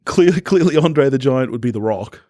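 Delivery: normal talking voice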